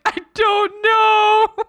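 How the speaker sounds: high, slow and loud, breaking into laughter; real laugh-cry, drawn out. Real, not acted. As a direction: say it helplessly through laughter. Laugh-crying, stranded.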